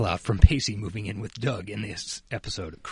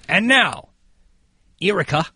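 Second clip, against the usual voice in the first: mock announcer; 4 semitones higher, slower than usual. Announcer handing over to cohost.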